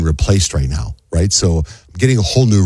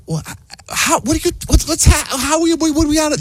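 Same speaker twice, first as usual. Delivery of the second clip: high-pitched, stumbling false starts; flustered stammer. Retold or imitated. Husband questioning wife about leaving, high flustered stammer. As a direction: trip over the questions in a high voice.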